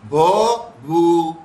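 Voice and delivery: "بھو، بھو" slowly as "bho... bhoo"; slow "bho", pause, "bhoo" for "بھو، بھو"; vowel contrast. Shown as a demonstration. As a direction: contrast the two "بھو": first "o", then "oo". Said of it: "دونوں کے اندر کتنا فرق ہے".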